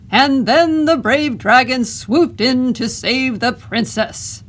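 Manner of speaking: big, slow, loud storyteller boom, pitched high. Acted. Boy reading aloud to lure the dragon, booming voice. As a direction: boom it out so the hiding dragon can't help but hear. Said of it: "in a booming voice".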